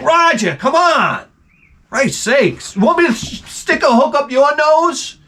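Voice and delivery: high, whiny complaint at a shipmate; exasperated high-pitched whine. Retold or imitated. Whaler whining high at a crewmate who hits the blowhole. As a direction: pitch it way up, fed up with him. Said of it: "And you're like".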